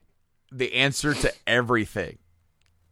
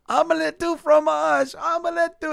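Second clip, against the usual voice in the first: very high, loud desperate cry; hammed-up frantic squeal; mock panic; 4 dB louder than usual. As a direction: imitate a cartoon boy frantic before the blast. Boy desperate before self-destruct.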